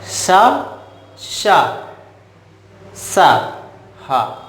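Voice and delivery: शा, षा, सा, हा sounded one by one; sibilant contrast demo. Shown as a demonstration. Teacher reciting the four ऊष्म व्यंजन, sibilant contrast demo. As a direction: isolate शा, षा, सा, then a breath-made हा. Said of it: "उच्चारण में यही अंतर है".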